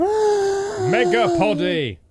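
slow booming high hype call; announcer voice. Acted. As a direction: stretch it like a trailer. Dramatic title announce.

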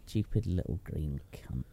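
slow spiteful whisper. Real, not acted. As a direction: hiss it under breath. Whispered insult.

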